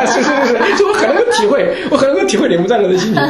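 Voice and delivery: high, loud laughing agreement; real laughter while agreeing. Real, not acted. Laughing while sympathizing with class.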